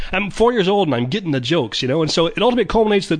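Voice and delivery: ordinary talk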